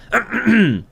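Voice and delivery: theatrical throat clear. Acted. Ant about to practice talking, clearing his throat. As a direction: clear the throat before speaking.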